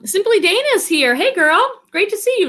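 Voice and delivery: bright, high greeting; excited greeting. Real, not acted. Welcoming a viewer who joins, high and excited. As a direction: greet a friend in the chat, upbeat.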